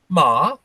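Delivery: "Ma" with a dip then a rise; Chinese tone demo. Shown as a demonstration. Teacher showing another Chinese meaning, "Ma" bent upward. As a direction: bend "Ma" upward at the end. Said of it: "it's the tone that you change".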